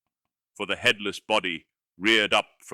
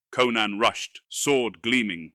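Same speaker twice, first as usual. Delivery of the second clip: plain reading voice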